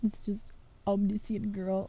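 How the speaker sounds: whispered joke aside. Real, not acted. Streamer joking to chat in a whisper. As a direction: breathe it like a secret punchline.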